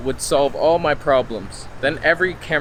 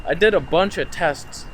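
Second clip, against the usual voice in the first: usual speaking voice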